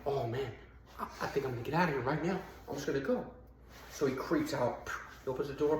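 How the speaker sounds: hushed sneaking. Retold or imitated. Prisoner sneaking out quietly.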